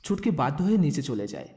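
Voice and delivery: ordinary delivery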